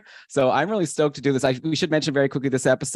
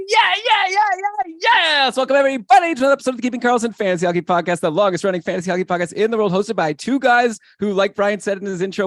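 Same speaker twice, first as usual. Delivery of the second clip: high, fast shouted podcast welcome; over-the-top excited host, pitched way up; hyped show opening; 8 semitones higher, 4 dB louder than usual. Real, not acted. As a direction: open with long yells of yeah, then rattle off the welcome.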